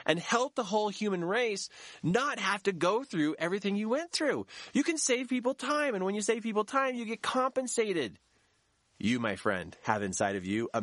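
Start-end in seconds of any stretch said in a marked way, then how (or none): 0.1-8.2 excited build. Real, not acted.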